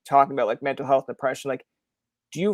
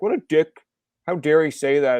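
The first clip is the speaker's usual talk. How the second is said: higher, louder mock outrage; mock indignation; 3 semitones higher, 5 dB louder than usual. Quoting people who object to the message, mock indignant. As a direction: play an offended commenter, briefly indignant. Acting out offended followers' reaction.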